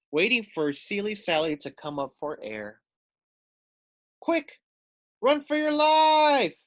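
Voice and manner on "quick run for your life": high, loud, slow shout. Acted. Foxy Loxy shouting warning to the polar bear queen. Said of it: "shouted Foxy Loxy".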